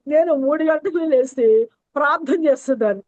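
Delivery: ordinary talk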